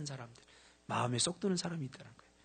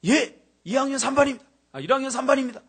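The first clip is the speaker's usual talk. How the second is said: higher, louder flustered reply; nervous freshman; 10 semitones higher, 12 dB louder than usual. Retold or imitated. Freshman answering the senior, flustered and raised. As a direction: answer fast like a startled new student. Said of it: "너 몇 반이야?".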